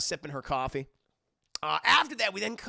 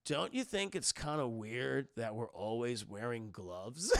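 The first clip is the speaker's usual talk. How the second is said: stoned stoner voice; 6 semitones lower, 6 dB quieter, slower than usual. Retold or imitated. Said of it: "he's like".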